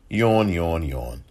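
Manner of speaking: bored, dropping yawn; mock yawn. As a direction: sound utterly bored by it. Mocking the boring statement.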